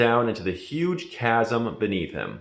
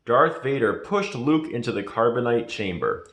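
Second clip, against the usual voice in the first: plain reading voice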